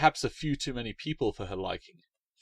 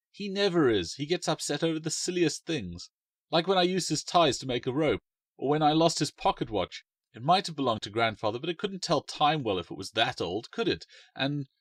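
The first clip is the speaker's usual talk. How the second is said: put-on higher, boyish complaining voice; a small boy grumbling about his father; whiny little brother, higher pitched; young Wally complaining; 6 semitones higher, 4 dB louder than usual. Wally's voice for long quote.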